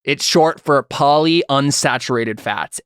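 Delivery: ordinary talk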